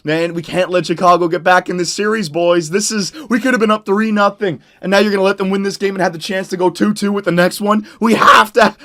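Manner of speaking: ordinary talk, nothing marked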